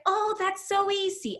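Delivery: high, airy and carefree; tutor's breezy reply. Retold or imitated. Architect tutor shrugging off algebra to a struggling teen, high and breezy. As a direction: toss it off as if it's nothing.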